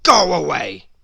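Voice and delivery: loud, curt snap; snapping. Acted. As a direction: bite the words off like a grouch. Grumpy shoos Happy off.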